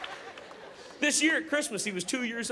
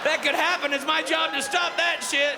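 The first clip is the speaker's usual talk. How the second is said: loud, slow, higher dad rant; protective shout; 8 semitones higher, 4 dB louder than usual. Acted. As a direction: bark it out like a fierce dad.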